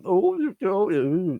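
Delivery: high-pitched wordless "oh, oh"; mock reaction noises. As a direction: imitate a useless reactor cooing in the background. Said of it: "in the background just going".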